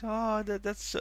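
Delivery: happy voice. Retold or imitated. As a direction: gush sweetly like a happy idol. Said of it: "that very, uh, happy voice".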